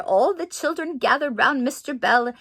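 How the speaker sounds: usual speaking voice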